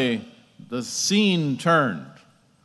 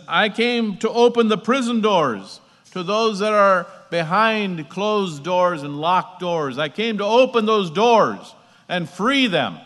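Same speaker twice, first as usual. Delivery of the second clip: higher, raised proclamation; emphatic preaching; 5 semitones higher, 4 dB louder than usual. Real, not acted. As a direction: preach the promise with force.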